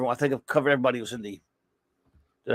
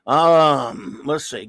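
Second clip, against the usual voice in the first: normal talking voice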